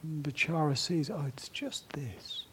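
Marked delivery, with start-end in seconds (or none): 1.1-2.4 slow, breathy, whispered realization; whispered and unhurried, barely voiced; soft whisper. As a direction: let it out as a soft recognition.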